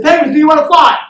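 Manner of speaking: high, booming pep-talk yell; rallying shout. Acted. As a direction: demand an answer from the whole room.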